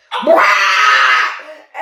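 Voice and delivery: loud wordless outburst, re-enacted; mock ballistic roar. Director re-enacting fury at wig in rehearsal, loud mock roar. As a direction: roar as if blowing your top. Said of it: "and I went ballistic".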